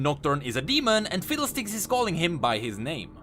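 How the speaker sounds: ordinary delivery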